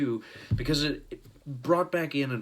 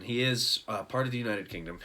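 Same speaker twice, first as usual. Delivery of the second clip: normal talking voice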